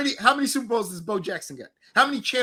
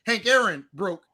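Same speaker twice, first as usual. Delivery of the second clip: normal talking voice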